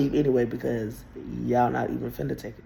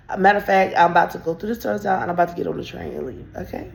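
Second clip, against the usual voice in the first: sassy retort; 8 semitones higher than usual. Retold or imitated. Her own retold reply.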